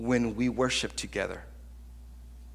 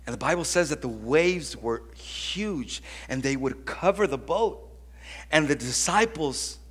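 usual speaking voice

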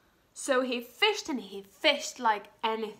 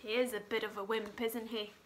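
ordinary delivery

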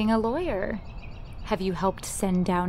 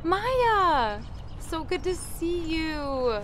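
high call of her name, then slow; light up as she arrives; excited greeting; 7 semitones higher, much slower than usual. Acted. Friend arrives late.

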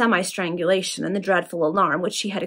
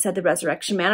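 plain reading voice

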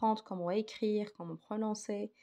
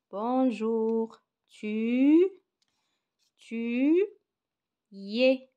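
slow modelling; 4 semitones higher, 7 dB louder, much slower than usual. Shown as a demonstration. Teacher modelling answer slowly.

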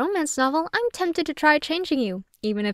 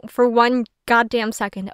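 normal talking voice

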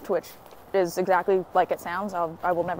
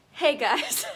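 ordinary talk, nothing marked

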